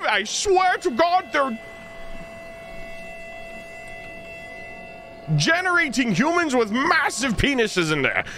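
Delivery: high, panicked, near-crying rant; frantic escaped witness. Retold or imitated. Player imagines telling people about the asylum, high panicked rant. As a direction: babble it like a traumatized survivor begging belief. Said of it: "and be like".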